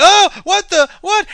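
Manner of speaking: high, loud mock panic; freaked-out students. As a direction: squeal it like a startled student. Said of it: "they're like".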